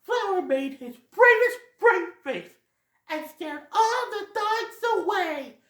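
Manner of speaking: put-on high, slow, childlike sister telling the tale; little sister voice. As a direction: squeak it out like an excited little sister. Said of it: "one of Supper's sisters says".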